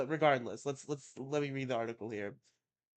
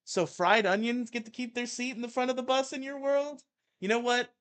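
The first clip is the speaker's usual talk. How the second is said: mock outrage; 11 semitones higher, 6 dB louder than usual. Mock outrage at onion haters.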